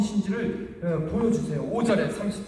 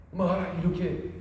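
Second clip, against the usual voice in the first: ordinary talk, nothing marked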